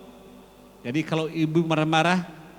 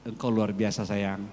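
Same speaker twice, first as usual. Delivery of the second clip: softer and lower, gentle and sincere; tender compliment; 8 semitones lower, 5 dB quieter than usual. Pretend. Husband praising his wife, soft, low and tender. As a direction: murmur it like praise meant only for your wife. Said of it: "Jadi bilang sama istrimu".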